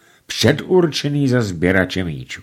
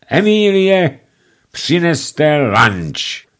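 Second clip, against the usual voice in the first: louder, higher, slow command; thundering; 8 semitones higher, 5 dB louder, much slower than usual. A narrator's reading. Master orders the maid to bring lunch, booming. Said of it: "zahřímal hlas páně Radův".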